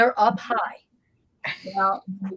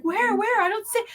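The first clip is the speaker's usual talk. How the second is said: high anxious mock voice; imitating scared friends; 14 semitones higher than usual. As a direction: play the friends, much higher and fretful. Friends panicking on the recording.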